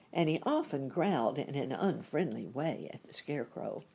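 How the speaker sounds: usual speaking voice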